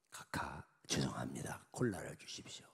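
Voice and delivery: hushed, apologetic whisper to a superior; timid whisper. Acted. General whispering a timid refusal to the president.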